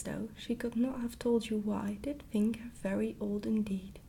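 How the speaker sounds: plain reading voice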